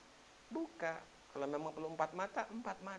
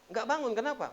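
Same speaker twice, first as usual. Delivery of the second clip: ordinary talk, nothing marked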